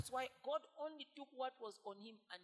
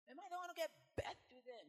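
high, louder upset question; upset complaint; 8 semitones higher, 5 dB louder than usual. Acted. Moses complaining to God, high upset question. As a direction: plead it, indignant. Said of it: "How do you say it in your language?".

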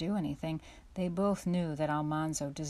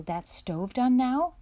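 raised, drawn-out call from the next room; 7 semitones higher, 7 dB louder, slower than usual. A narrator's reading. Bossy sister calls to her brother from the parlor, loud and slow. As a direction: call out slowly, higher and louder. Eliza Jane calling from parlor.

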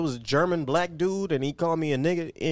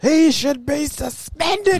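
much higher, very slow pundit outrage; Stephen A impression; 12 semitones higher, much slower than usual. Mocking a TV pundit demanding a suspension, much higher and slow. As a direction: stretch it out like an indignant TV pundit. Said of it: "That's definitely what Stephen A would say".

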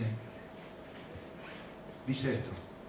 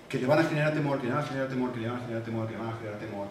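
each repeat softer and lower; repeated phrase dying down; fading repetition; 6 semitones lower, 4 dB quieter, much faster than usual. Shown as a demonstration. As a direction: let the phrase sink away four times. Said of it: "repeated phrase fading".

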